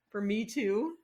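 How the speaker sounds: ordinary delivery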